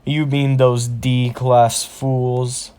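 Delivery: slow, scornful orc boss voice; orc shaman. Acted. Orc shaman dismissing his fallen soldiers, slow and scornful. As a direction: drag it out with villainous disdain. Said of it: "The orc shaman starts laughing".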